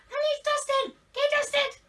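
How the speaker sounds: very high, loud squeaky impression; mock shriek, comically unlike the real voice; Mister Producer. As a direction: squeal it in a silly put-on voice, drawn out at the end. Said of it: "Mister Producer doesn't talk like that".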